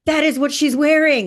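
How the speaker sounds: a client blurting out that it's right; excited confirmation. Retold or imitated. Client amazed the vision matched, loud excited quote.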